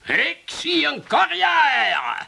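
high, slow, soaring declaration; grand proclamation. Acted. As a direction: stretch it out like a stage visionary. Proclaims his future stardom.

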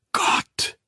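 breathless whispered cry. A narrator's reading. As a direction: breathe the word out in anguish. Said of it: "Er flüsterte es laut".

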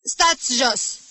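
loud, high command; sharp ringing voice. Acted. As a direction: snap the class down to their seats. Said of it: "o voce ascuțită și sonoră". (voce ascuțită și sonoră)

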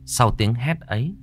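ordinary delivery

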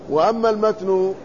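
normal talking voice

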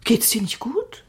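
hushed concerned whisper; worried whisper. Acted. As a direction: whisper it close, checking on him. Mother worried about frightened son.

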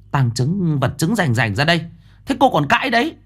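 high, indignant scolding; mock her denial with scorn; scornful retort. Mother-in-law scoffs at her daughter-in-law's denial, high and scornful. Bà Lai snapping back.

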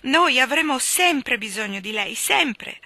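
high, loud heartfelt outcry; loud, urgent pledge of devotion; impassioned cry. A narrator's reading. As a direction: cry it out as you cling to someone. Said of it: "gridò stringendosi al petto".

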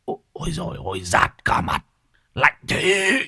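breathy yelps rising high; yelping in pain. Acted. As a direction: cry out stung by cold smoke. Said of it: "kêu lên oai oái". (kêu oai oái vì rát mặt)

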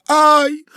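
short high loud yelp; startled lady's shriek. A narrator's reading. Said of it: "вскрикнула дама". (испуганный вскрик)

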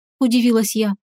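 plain reading voice

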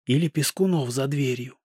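plain reading voice

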